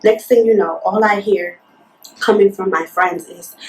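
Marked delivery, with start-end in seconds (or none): none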